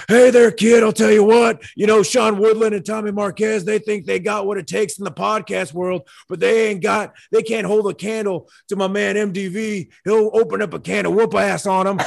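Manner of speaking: brash trash-talking promo, shouted high; Stone Cold Steve Austin. Retold or imitated. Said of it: "I can give you a good Austin".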